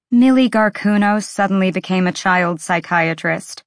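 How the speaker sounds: usual speaking voice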